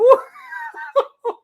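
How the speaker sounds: high, tearful whoop of a laugh; joyful laugh. Acted. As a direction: burst into a laughing cry.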